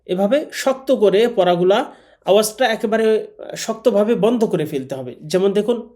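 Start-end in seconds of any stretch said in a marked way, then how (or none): none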